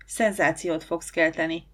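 ordinary delivery

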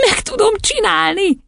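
very high, squealed cheer; jubilant. A narrator's reading. Matilda rejoicing at her new power, jubilant. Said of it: "újjongott".

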